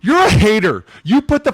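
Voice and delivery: loud high teasing accusation; loud accusing. Real, not acted. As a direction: call them a hater loudly. Heated argument.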